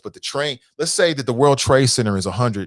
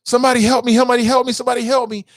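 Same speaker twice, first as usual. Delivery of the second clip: high, loud desperate cries for help; mock-panicked calls for help, pitched high; panicked plea; 11 semitones higher, 4 dB louder, much faster than usual. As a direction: imitate a trapped man begging on the phone. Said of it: "He's on the phone".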